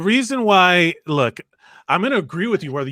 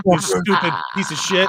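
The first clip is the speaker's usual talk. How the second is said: mock insult. Joking wife insult.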